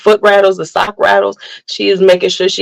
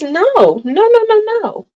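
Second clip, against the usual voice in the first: high, rising string of protests; imitated refusing child; 13 semitones higher than usual. Mom imitating her child who doesn't want something, high refusal. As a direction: mimic a toddler's shrill little refusal. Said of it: "then she does this when she doesn't want something".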